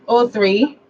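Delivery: each digit spaced out slowly; slow dictation. Shown as a demonstration. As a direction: read the number for someone writing it down. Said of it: "Are you ready?".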